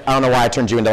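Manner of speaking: normal talking voice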